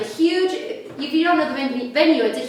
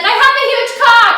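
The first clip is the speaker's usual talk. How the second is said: high, loud mock-proud boast; proud announcement; 11 semitones higher, 9 dB louder, faster than usual. Comic imitating the guy loudly boasting. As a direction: announce it loudly like big news.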